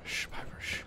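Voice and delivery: hushed, voiceless shush; whispered shushing. Pretend. Gamer hushing companion Piper, whispered shushing. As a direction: shush them under your breath.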